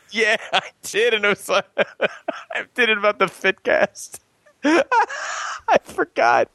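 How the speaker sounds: giggling through the words; talking while laughing, pitch high. Real, not acted. Giggling while confessing forgotten video.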